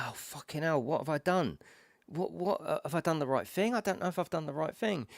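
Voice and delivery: second-guess yourself anxiously; mildly acted regret and worry; worried self-doubt. Acting out doubt after wife's text.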